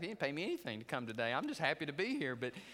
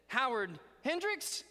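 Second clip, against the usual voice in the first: teacher calling roll; 9 semitones higher, 5 dB louder, slower than usual. Retold or imitated. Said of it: "And she said".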